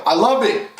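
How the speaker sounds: ordinary talk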